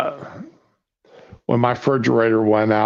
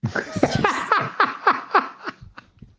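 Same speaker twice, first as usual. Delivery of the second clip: high laugh; 3 dB quieter than usual. Real, not acted. Laughing at shrimp joke.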